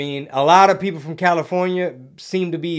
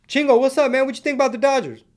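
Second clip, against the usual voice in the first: much higher, eager fan calling out a question; imitating a fan; 9 semitones higher, faster than usual. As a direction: play a chatty fan pressing a question. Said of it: "We coulda just played dumb. Like".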